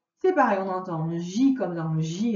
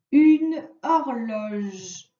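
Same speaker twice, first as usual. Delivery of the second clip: slow dictation; 3 dB louder, much slower than usual. Shown as a demonstration. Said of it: "On passe à la ligne".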